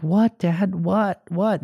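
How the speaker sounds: high, repeated innocent questioning; mimicked kids answering Dad; imitated kids. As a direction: protest like small children caught out. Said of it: "they're like".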